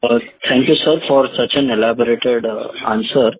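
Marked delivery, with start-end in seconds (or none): none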